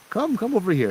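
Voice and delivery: high-pitched coaxing call; mock beckoning call. Imitates calling a tow driver over.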